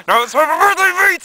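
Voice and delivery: high, loud holler; dad yelling. Acted. Son acts out dad before punching him, yelling. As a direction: shout it high and rowdy.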